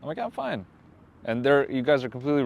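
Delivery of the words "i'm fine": very high, soft squeaky reply; high-pitched pretend okay; mock reassurance. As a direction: act out an unconvincing brush-off in a raised voice. Acting out his defensive reply.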